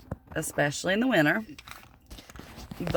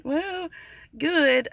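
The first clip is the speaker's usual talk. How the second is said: high, drawn-out fake-fine "good"; put-on cheerful; 11 semitones higher, 4 dB louder than usual. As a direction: answer brightly as if all is well, covering up. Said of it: "it's probably a lie".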